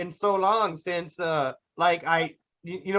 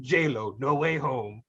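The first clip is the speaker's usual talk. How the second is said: low movie-trailer style delivery; low title announcement; 8 semitones lower, 4 dB quieter, slower than usual. Acted. Pitching the made-up movie title, low announcer delivery. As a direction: announce the title in a deep, measured voice.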